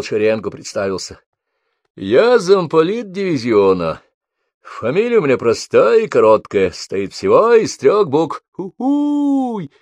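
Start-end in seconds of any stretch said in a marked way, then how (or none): 2.0-9.7 loud officer voice. A narrator's reading. Major introduces himself to recruits.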